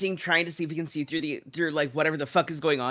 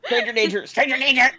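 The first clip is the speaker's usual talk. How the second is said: higher, louder alarm call; panicked yell; 8 semitones higher, 9 dB louder than usual. Acted. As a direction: yelp for help as he's grabbed. Character panics at a hugging stranger.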